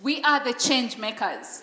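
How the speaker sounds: loud, firm declaration for the crowd to echo; with conviction. Shown as a demonstration. Speaker leading an audience in affirmations, loud and firm. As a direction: call out each affirmation so they repeat it. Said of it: "Please say this with conviction".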